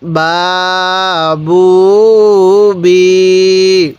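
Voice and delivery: very slow, each vowel stretched long; drawn-out syllables. Shown as a demonstration. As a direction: stretch সা সু সি for a learner. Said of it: "তাহলে". (টেনে টেনে ধীরে উচ্চারণ)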